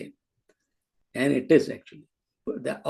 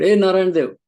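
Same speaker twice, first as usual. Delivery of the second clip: loud, higher call across the hall; retold shout; 5 semitones higher, 8 dB louder than usual. Old mother shouting at the swami across the crowd, loud call. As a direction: call him out loudly from a distance. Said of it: "She shouted".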